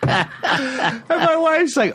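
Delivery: speaking through laughter; slow and high, laughing. Real, not acted.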